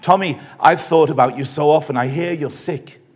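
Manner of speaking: ordinary delivery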